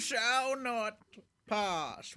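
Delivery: lightly voiced wizard proclamation; a hint of epic wizard command; Gandalf. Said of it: "cue epic Gandalf".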